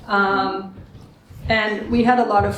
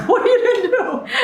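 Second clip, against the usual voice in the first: mock alarm; 13 semitones higher, 3 dB louder, much faster than usual. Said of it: "And I'm".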